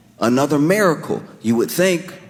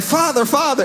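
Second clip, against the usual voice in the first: high frantic followers calling Father; 12 semitones higher than usual. Retold or imitated. Followers find the crashed leader, frantic high call. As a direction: voice the panicked followers calling him.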